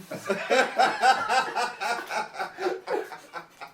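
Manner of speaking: high, loud extended laughter; long laugh. Real, not acted. Long laugh after the joke.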